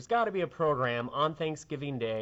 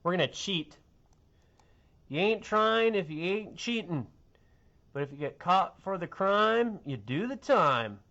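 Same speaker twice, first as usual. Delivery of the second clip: drill sergeant's motto; 7 semitones higher, 4 dB louder than usual. Retold or imitated. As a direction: bark it like a drill instructor. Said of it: "That was my drill sergeant's motto".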